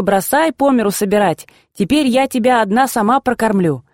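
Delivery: ordinary delivery, nothing marked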